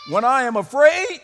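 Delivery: emphatic declaration. Acted. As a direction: declare it forcefully to the whole church. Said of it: "he emphatically declares".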